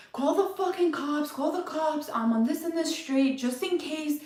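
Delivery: high, urgent retold yell at best friend; begging her to call the police, high and pressed; panicked plea. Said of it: "I'm just like".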